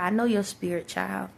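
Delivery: ordinary talk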